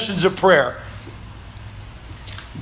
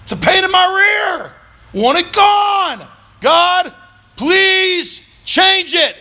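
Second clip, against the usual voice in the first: Paul begging; 13 semitones higher, slower than usual. Retold or imitated. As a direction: whine it out like a fed-up apostle. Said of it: "He was like".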